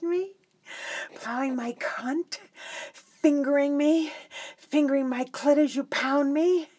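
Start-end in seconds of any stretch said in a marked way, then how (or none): none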